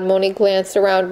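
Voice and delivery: ordinary delivery, nothing marked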